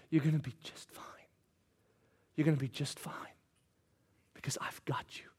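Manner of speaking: soft, breathy whispered comfort; tender whisper. Acted. Jesus comforting failed Peter, tender whisper. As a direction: whisper it like soothing a hurt child.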